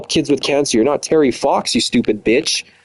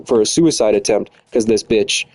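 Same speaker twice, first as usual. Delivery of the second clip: ordinary talk, nothing marked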